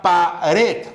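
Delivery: "pared" split as pa-RED, RED stressed; stress on last syllable. Shown as a demonstration. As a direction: separate "pared" and lean on RED. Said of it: "el acento está en la última posición". ("pared" separada, pa-RED, con acento en la última)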